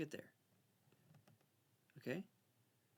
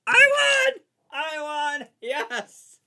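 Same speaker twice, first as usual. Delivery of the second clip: much higher, much louder victory cheer; triumphant shout; 14 semitones higher, 20 dB louder, much slower than usual. Real, not acted. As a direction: scream with joy at winning. Cheering the win.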